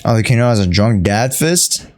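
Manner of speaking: usual speaking voice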